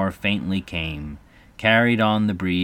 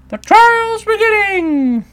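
high, drawn-out, loud call; distant cry; 10 dB louder, slower than usual. Acted. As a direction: call it out slowly like a crier. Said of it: "a cry of".

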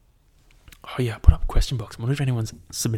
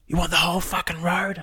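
higher mock yell from a rude driver; imitating the angry driver; 8 semitones higher than usual. Driver yelling abuse at the cyclist, imitated mock yell. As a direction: mimic the driver yelling out her window. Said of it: "she fucking stopped and was like".

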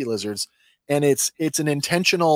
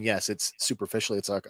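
ordinary talk, nothing marked

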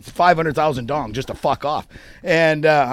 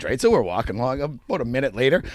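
ordinary talk

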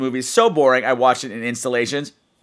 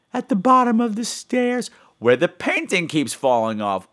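put-on old woman voice; 8 semitones higher than usual. Old woman Agnes confesses.

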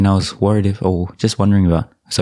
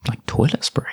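soft, higher, half-whispered puzzlement; puzzled hushed quote; 5 semitones higher, 4 dB quieter than usual. Retold or imitated. Recalling himself as a high school student reading the label, puzzled and hushed. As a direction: lightly imitate a baffled mutter under the breath.

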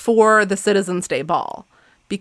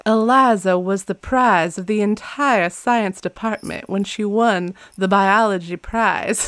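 put-on slow old-time Georgia drawl; Southern belle accent. Shown as a demonstration. Host imitating an accent tape, slow Southern belle drawl. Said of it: "Southern Belle kind of accent".

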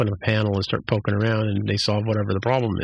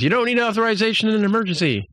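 high, fast, frantic officer voice; imitating a flustered junior officer yelling at the computer; panicked Boimler; 12 semitones higher, faster than usual. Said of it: "at which point he says".

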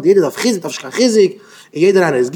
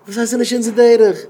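indignant retort; 6 semitones higher, faster than usual. Acted. Throws the objection back.

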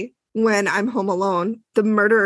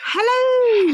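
high drawn-out greeting; sing-song hello; 13 semitones higher, 3 dB louder than usual. Real, not acted. Greeting her cohost, high and sing-song.

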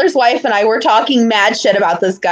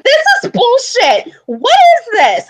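high, indignant tantrum outburst; mock tantrum; 11 semitones higher than usual. Fan tantrum at Raw, high indignant outburst. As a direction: throw a fake fit in the stands. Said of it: "having a full-on tantrum".

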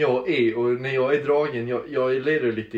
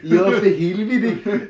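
mock artist's yes; 5 semitones higher, 4 dB louder, much slower than usual. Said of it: "Han bara".

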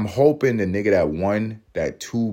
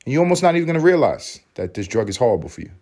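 rushed, higher-pitched drug ad disclaimer; very quick ad voice; 5 semitones higher, faster than usual. Retold or imitated. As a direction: race through it like a commercial's fine print. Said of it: "She's gonna speak very quickly".

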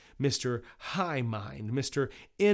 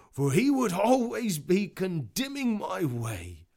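higher put-on character voice; complaining juror; 8 semitones higher, 4 dB louder than usual. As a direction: voice an aggrieved juror, pitched up. Juror complains in acted trial.

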